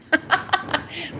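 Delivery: soft airy laugh; quick high breathy giggle. Real, not acted. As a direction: giggle under your breath. Laughing at her own joke.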